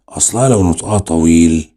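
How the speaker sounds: ordinary talk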